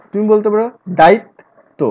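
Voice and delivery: usual speaking voice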